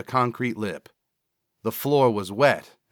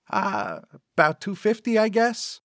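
high, hesitant nervous man; 11 semitones higher than usual. Acted. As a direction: mumble it out uneasily. Cecil answers nervously.

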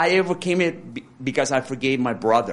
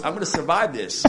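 laughing; 3 semitones higher than usual. Retold or imitated. Joking about train plan.

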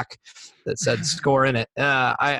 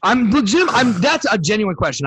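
higher, louder self-defence; indignant protest; 6 semitones higher, 4 dB louder than usual. Real, not acted. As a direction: insist you really meant it, voice jumping up. Protests after being cursed at for question.

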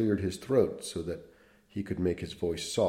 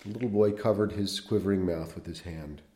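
measured literary read-aloud; unhurried storyteller voice; story narration; 2 semitones higher, 4 dB louder than usual. Acted.

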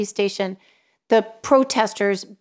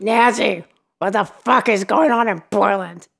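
Jake impression. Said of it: "he's, like, growly but sexy".

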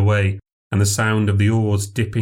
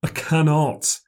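high, pained refusal; anguished castaway; 8 semitones higher, slower than usual. A narrator's reading. Castaway refusing to come alongside, anguished. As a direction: refuse with helpless regret.

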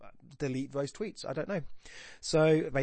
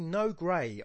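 "no grey" higher and louder, both words stressed; two equal stresses; 5 semitones higher, 5 dB louder than usual. Shown as a demonstration. Teacher showing a spondee, both words of "no grey" stressed. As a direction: give "no" and "grey" a full beat each. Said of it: "that's two stressed syllables".

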